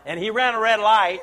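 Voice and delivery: put-on loud, high child telling on someone; mock tattling. Grandkids report Papa at home, loud tattling. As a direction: blurt it out like a tattletale.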